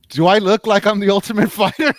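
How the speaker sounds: high, loud sitcom impression; mock indignant boyfriend. Battered boyfriend answering his girlfriend, mock indignation. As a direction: push it high and loud, rising at the end. Acts boyfriend in full body cast.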